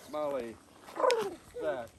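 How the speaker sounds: high, loud raven call of the names; raven hello call. Shown as a demonstration. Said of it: "that's how ravens say hello".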